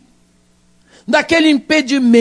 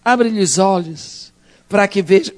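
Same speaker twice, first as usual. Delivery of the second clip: lower, softer, simple prayer; quiet prayer; 5 semitones lower than usual. Acted. Prophet prays for his frightened servant, lower and softer. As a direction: pray it gently, close to God. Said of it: "fez a seguinte oração".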